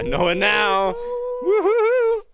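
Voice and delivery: squealing while tickled; shrieking laughter under tickling; being tickled. Real, not acted.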